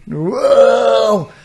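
high startled whoa, thrown off by gum; mock startled whoa. Lad hit by thrown chewing gum, startled high whoa. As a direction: yelp like something just hit you. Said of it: "And I was like".

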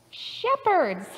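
ordinary talk

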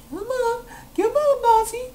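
tiny high pleading voice; very high-pitched wheedling; coaxing plea. Real, not acted. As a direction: coax it in a squeak. Coaxing the boss.

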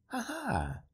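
short, light, high gleeful laugh; a quick spurt of laughter at the sky. Acted. The stranger laughs gleefully at the clergyman's plight. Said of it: "laughed suddenly, amusedly".

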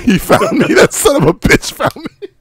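high, mock-panicked cry. Terrified man tells of being found, high and panicky. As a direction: squeal it out in fake terror.

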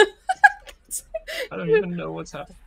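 high, loud laughing panic. Real, not acted. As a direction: laugh through the words. Laughing while being taken.

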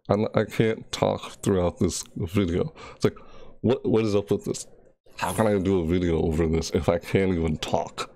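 ordinary talk

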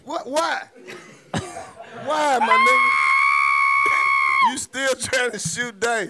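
incredulous shout. Real, not acted. Friend yelling in disbelief at the blind dice player. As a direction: bellow it in total disbelief.

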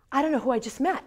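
high and loud with breathless excitement; excited high rush. Retold or imitated. Bursting into boss's office.

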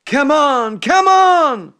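loud rising wake-up call; shouting to wake. A narrator's reading. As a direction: yell him out of bed. Klopman waking Jack, shouting.

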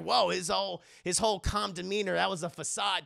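ordinary delivery

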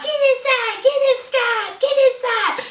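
high frantic repeated order; shrill little-boy panic, mocked; hammed-up urgent high calls; mock Michael. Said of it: "talking about".

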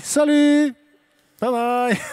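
high, loud, drawn-out farewell; calling out. Real, not acted. Host waving someone off on stage, calling out. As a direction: call the goodbye out high and bright.

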